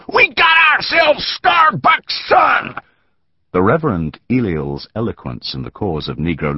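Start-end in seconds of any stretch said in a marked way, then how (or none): 0.1-2.8 very high, slow triumphant scream; victory scream. A narrator's reading. Bearded man screaming his find to the mob. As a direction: crow it out to the whole mob. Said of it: "He screamed his victory to the mob".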